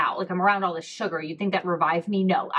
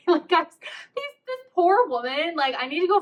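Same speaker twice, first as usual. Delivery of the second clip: real giggles breaking through high-pitched words; laughing while talking; 8 semitones higher than usual. Real, not acted. Storyteller pitying the poor cashier, laughing as she talks. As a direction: talk through helpless amusement.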